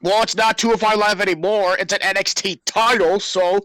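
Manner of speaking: ordinary delivery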